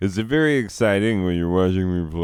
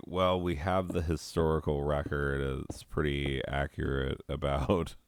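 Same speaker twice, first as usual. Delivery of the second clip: mock know-it-all; 5 semitones lower, 6 dB quieter than usual. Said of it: "everybody's always like".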